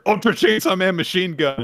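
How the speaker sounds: high, loud battle cry; shouted declaration. Acted. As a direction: yell the attack name. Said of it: "Yoru tries to declare".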